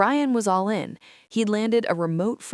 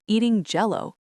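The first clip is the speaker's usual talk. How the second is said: ordinary delivery, nothing marked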